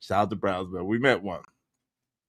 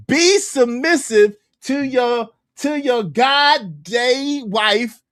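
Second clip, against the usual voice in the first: mock preacher; 6 semitones higher, 4 dB louder, slower than usual. Mocking clip again.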